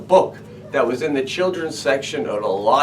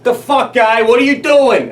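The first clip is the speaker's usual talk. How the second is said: loud, high yell at another driver; road rage yell; 12 semitones higher, 11 dB louder than usual. Retold or imitated. Dad driving, yelling at another driver. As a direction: bark it at the car cutting you off. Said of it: "and I'm like".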